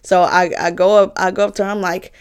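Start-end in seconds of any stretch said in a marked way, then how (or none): none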